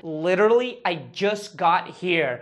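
slow, drawn-out stress on "Literally"; emphatic. Shown as a demonstration. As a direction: stretch "Literally" for emphasis, then take the rest slowly. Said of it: "Que é pra dar essa ênfase".

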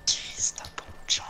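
whispered swearing under breath; whispered curse. Real, not acted. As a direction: hiss it, barely voiced. Muttered curse after death.

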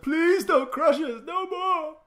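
high, loud, wailing mock plea for mercy; mock pleading cries. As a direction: ham up a beaten enemy begging. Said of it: "Your cries, like".

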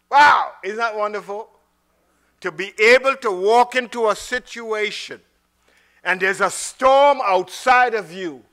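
loud, high exclaiming; excited exclaim. Real, not acted. Preacher marvelling to the congregation, loud and bright. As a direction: lift the room with wonder.

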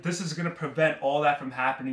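ordinary delivery

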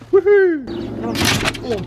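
high joyful whoop; excited cheer. Real, not acted. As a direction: cheer the trip on. Cheering at road trip start.

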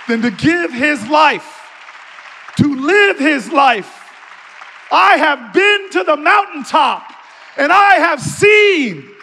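loud, ringing oratorical climax; full-force preaching. Real, not acted. Speaker rallying church to stand in the storm, preaching full force. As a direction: drive each phrase home at a shout, rallying the congregation.

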